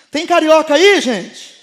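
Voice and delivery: loud crowd call, dropping at the end; rousing shout to the audience. Real, not acted. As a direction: holler it out to the whole congregation. Calling out to the congregation.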